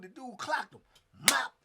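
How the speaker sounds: ordinary talk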